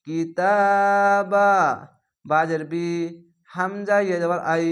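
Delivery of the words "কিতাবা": slow drawn-out Quranic chant; Quran recitation. Shown as a demonstration. Quran teacher chanting a word for students, slow and drawn out. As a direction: chant কিতাবা slowly in tajweed style.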